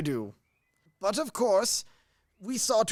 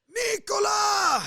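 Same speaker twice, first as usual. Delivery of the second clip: shouting for servant; 14 semitones higher, slower than usual. Acted. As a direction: bellow the servant's name. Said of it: "Oh, don't shout".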